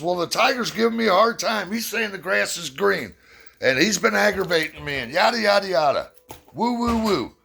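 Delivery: high, worked-up donkey, whining on and on; whiny donkey. Acted. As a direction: tattle to the king in a squeaky rush. Donkey complains to the lion.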